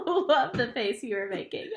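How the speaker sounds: amused, laughing while praising. Real, not acted. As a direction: laugh through the words. Laughing at client's face.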